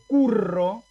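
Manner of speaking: curro with a long trilled rr; Latin double r. Shown as a demonstration. Teacher showing students how to say curro. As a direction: say curro rolling the double rr hard. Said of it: "Yo digo".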